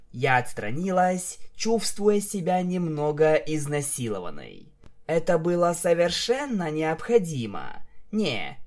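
plain reading voice

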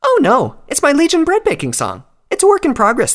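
bubbly and eager; bubbly high voice. Acted. Pinkie's cheery voice.

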